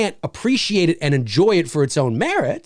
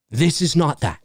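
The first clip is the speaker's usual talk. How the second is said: normal talking voice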